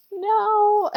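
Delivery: high, loud mock wail; hammed-up child's tantrum no; dramatic child. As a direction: whine it out like a refusing kid. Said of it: "she was being so dramatic".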